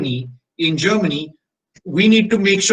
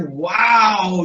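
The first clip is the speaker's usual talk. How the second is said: amazed wow; 2 semitones higher than usual. Retold or imitated. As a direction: burst out in delight. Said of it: "I was like".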